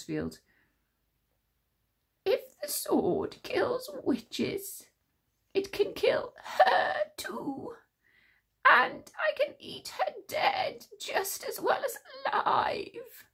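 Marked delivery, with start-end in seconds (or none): 2.3-13.3 very high, slow hungry creature voice; King Witch whispering his plan to Tsar. A narrator's reading. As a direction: relish every word. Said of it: "whispered the King Witch".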